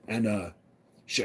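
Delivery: usual speaking voice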